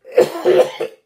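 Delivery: sharp loud cough. Real, not acted. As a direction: cough hard. Coughing fit.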